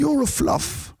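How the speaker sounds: very high mock English taunt; high-pitched English-accented tease. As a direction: jeer in a squeaked-up English accent. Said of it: "Oh, it's English, I guess".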